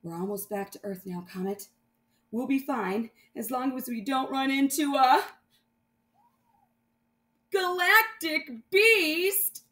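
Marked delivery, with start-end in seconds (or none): 2.3-9.7 ominous. Acted. Dread building.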